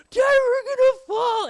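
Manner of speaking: very high, loud, slow frightened wail; mock panicked kid. Kids slipping off merry-go-round, mock panicked cry. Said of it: "we're like".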